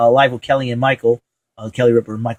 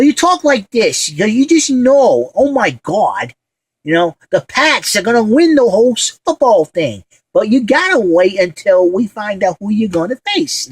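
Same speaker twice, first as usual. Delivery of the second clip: put-on high voice with Fall River accent; Portuguese Pesci; 8 semitones higher than usual. Worker imitating the boss's Fall River accent, high-pitched. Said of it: "he sounds like Joe Pesci with a Fall River accent".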